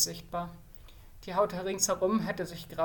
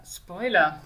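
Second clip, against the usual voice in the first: louder teasing spoiler; 7 dB louder than usual. Pretend. The narrator warning listeners, louder. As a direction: punch the last spoiler out loud. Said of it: "repeated teasing, louder".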